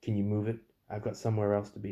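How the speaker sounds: loud, pushy mock driver; impatient customer. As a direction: hurry them like a rude customer.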